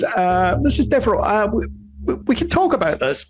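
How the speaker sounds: high, stammering appeasement; flustered, high-voiced placating; nervous guard. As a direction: backpedal before an angry matron. Guard placates scolding woman.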